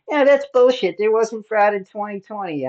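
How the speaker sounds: high, loud mocking retort; upset, retold. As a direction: imitate her indignant denial. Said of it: "she got all upset at us".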